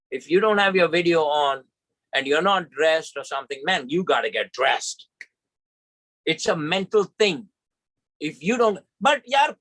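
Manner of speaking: ordinary delivery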